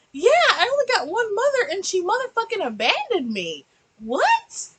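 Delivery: high, loud incredulous comeback; sarcastic retort. Retold or imitated. Vlogger answering the dad's one-mother cliche, high and loud. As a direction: fire back in disbelief. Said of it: "And I'm like".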